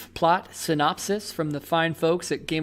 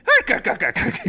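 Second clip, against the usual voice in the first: pirate ark; 7 semitones higher than usual. Acted. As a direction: bark it like a cartoon crab. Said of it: "It's just a pirate voice".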